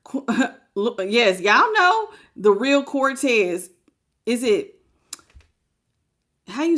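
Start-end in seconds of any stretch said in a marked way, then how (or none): none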